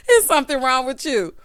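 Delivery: real laugh, loud and high, words through the laughter; laughing hard. Real, not acted. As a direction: crack up, then squeeze the words out laughing. Recapper cracks up at Irish.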